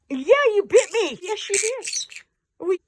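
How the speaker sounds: high and teasing, drawn out; high playful voice. Real, not acted. Playful scolding of a pet bird.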